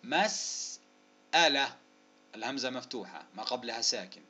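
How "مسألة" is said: "مسألة" split before the hamza, very slow; slow sounding-out of "مسألة"; syllable-by-syllable. Shown as a demonstration. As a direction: hold the "s" of "مسألة", pause, then finish. Sounding out word for spelling.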